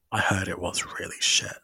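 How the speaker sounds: whispered gossip aside. Real, not acted. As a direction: whisper it like a secret.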